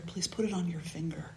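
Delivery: soft whispered request; the beggar softly urges the king, whispered; whispered plea. Acted. Beggar gently urging the king.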